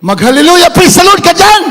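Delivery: high, loud excited call to praise; loud exclaimed urging. Retold or imitated. Brother urges the kidnapped husband to praise, loud exclamation. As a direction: belt it out like a preacher urging him on. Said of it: "Sabi ng kapatiran natin".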